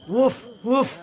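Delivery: happy bark; dog bark. Acted. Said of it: "the dog said".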